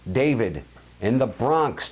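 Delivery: ordinary talk, nothing marked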